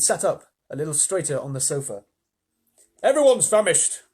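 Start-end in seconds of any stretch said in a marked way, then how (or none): 3.0-4.0 higher, louder centipede. Acted. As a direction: announce it boldly. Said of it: "he said".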